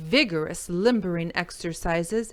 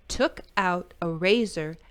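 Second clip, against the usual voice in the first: ordinary delivery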